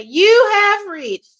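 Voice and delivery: slow, high, loud recorded-message voice; voicemail greeting. Retold or imitated. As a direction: drag it out like an answering machine.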